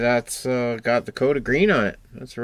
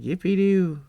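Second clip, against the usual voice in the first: mock cheer; 9 semitones higher, 5 dB louder than usual. Mock cheer while building.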